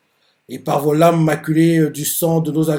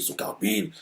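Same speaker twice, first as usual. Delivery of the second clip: stunned whisper; 11 dB quieter than usual. Retold or imitated. Panicking foot soldier repeating the enemy count, whispered. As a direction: whisper it, unvoiced, as a frightened soldier. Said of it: "C'est pas qu'ils font".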